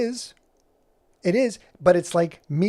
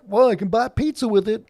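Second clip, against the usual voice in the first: loud, higher-pitched imitation of amazed buyers; mock amazement; 5 semitones higher, 8 dB louder than usual. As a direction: play an early crypto buyer marvelling. Mimics amazed early crypto buyers.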